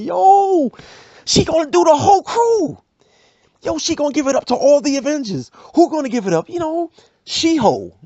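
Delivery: very high, loud gossip hype; hyped instigator. Acted. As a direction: shout the rumor to the crowd. Instigators hyping outside door.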